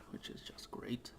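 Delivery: dry unvoiced whisper, deadpan; sarcastic whisper. Real, not acted. Grumbling to himself about the water, sarcastic whisper.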